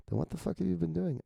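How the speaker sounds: high, quick incredulous demand; mock exasperation. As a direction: act out an exasperated comeback, high and fast. Heated comeback in dialogue read aloud.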